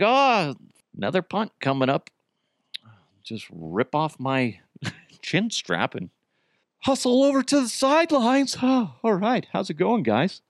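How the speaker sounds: lazy, listless mock quarterback; mock lethargic Wilson. Mocking the quarterback trudging off after another punt, listless.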